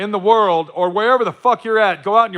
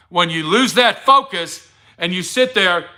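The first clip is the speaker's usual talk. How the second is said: normal talking voice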